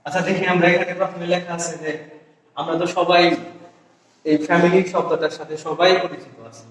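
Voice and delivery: ordinary talk, nothing marked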